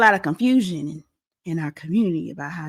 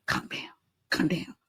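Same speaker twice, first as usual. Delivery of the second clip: breathy whispered calm down, calm down; imagined whisper; 3 semitones lower, 10 dB quieter than usual. Retold or imitated. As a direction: whisper it like soothing a man in a headlock. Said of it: "my imagination is".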